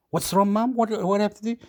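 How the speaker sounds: high, pleading whine to mum; mock-whining at his mum, pitched high; a son whining high at his mum over being shot; whiny protest. Whining to mum about Chile.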